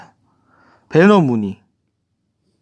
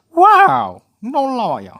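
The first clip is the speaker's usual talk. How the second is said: hammed-up amazement; 10 semitones higher, slower than usual. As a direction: play up mock wonder for viewers. 기능을 보여주며 과장된 감탄. (높은 소리로 과장되게 놀라는 척)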